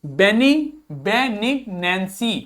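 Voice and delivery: बेनिग्नेंसी very slowly, be-nig-nen-see; slow pronunciation drill. Shown as a demonstration. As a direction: stretch बेनिग्नेंसी out for the class. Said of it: "अगला देखिए". (बेनिग्नेंसी धीरे-धीरे बोलकर दिखाना)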